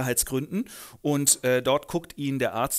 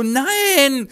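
lauter, hoher Aufschrei; entsetztes Nein; 11 semitones higher, 10 dB louder than usual. Acted. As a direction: schrei es entsetzt heraus. Franz erschrickt beim Einbruch. (Franz entsetzt über den Einbruch, laut)

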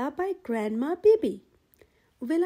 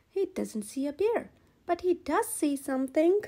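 plain reading voice

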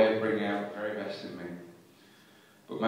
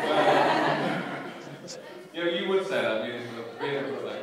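laughing; 5 semitones higher, 4 dB louder than usual. Real, not acted. Laughing reply to heckle.

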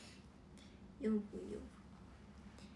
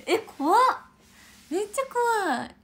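scared; 10 semitones higher, 10 dB louder than usual. Real, not acted. Reacting to bear encounter story.

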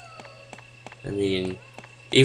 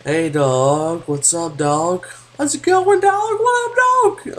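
talking to a dog; 9 semitones higher, 7 dB louder, slower than usual. Acted. Greeting a game dog playfully.

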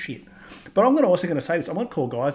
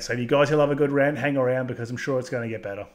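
normal talking voice

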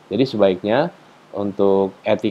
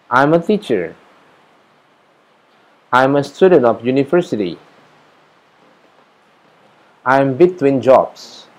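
slow model answers; 4 semitones higher, 5 dB louder, slower than usual. Shown as a demonstration. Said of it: "menjawabnya bisa seperti ini".

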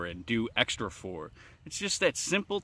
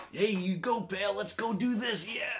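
cheerful encouragement; 9 semitones higher than usual. Retold or imitated. Cheering on your children.

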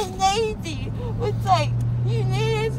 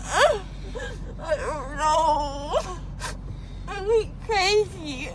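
after wisdom teeth; 5 semitones lower, 5 dB quieter, much slower than usual. Real, not acted. Crying, drugged after surgery.

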